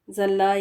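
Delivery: زلہ as "zal-la", starting on z; mistaken z sound. Shown as a demonstration. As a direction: say زلہ with z for Daad. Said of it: "اس کو بھی زلہ پڑھا جاتا ہے".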